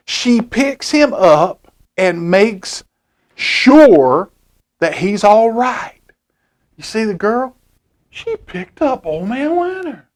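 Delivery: plain reading voice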